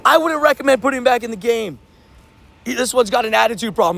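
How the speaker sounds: retold ref yell. Ref calling to the coach about the player, mock yell. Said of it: "he screamed to my coach".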